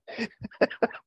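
high breathy burst of laughter; laughing. Real, not acted. Pastor laughing at a flopped joke, high laugh.

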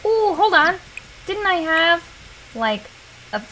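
ordinary delivery

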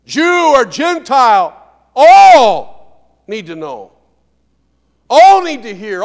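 slow, loud, each word landed; emphatic proclamation. Acted. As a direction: proclaim it slowly to the whole room.

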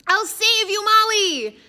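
high, loud heroic cry; valiant shout. A narrator's reading. Raj shouting as he rushes to save Molly. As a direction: charge in yelling to the rescue. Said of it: "Raj valiantly joined the fray".

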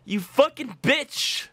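high, loud enraged yelling; real gamer rage; furious shout. Real, not acted. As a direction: scream at the slow player. Rage at teammate while gaming.

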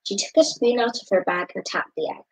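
ordinary delivery, nothing marked

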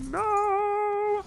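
very high, loud drawn-out no; screamed no of dismay; anguished cry. Real, not acted. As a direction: wail it in despair. Last energy refill used.

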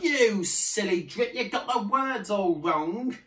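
loud, high telling-off; high scolding shout; mocking yell. A narrator's reading. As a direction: yell it scornfully at a silly sibling. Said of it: "but the others yelled".

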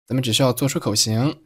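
usual speaking voice

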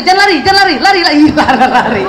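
a hammed-up shout of "lari" over and over, breaking into a laugh; mock nagging yell. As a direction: play the frazzled mother yelling at her running child, half laughing. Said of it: "Kadang-kadang jangannya gitu".

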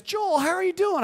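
high-pitched, acted enthusiasm; retold hearty greeting. Client greets photographer after years apart, heartily. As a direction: imitate an effusive hello.

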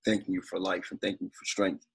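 quiet, earnest prayer voice. Acted. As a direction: pray softly over the family. Opening prayer at family gathering.

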